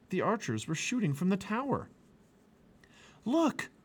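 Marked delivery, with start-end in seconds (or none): 3.2-3.7 high sudden cry; boy spotting an escape while arrows fly. Acted. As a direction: call out in alarm. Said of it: "cried Jack".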